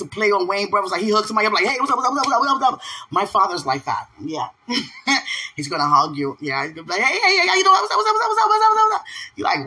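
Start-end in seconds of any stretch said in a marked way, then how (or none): 1.6-2.8 high, rapid-fire excited greeting; Marlon Wayans. Retold or imitated. Comedian hugging somebody on his show, imitated hype greeting. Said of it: "You know Marlon Wayans". 7.0-9.0 very high, loud, excited repetition; hyped-up father greeting. Retold or imitated. Father hugging his new acquaintance, imitated hype greeting. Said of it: "very hype".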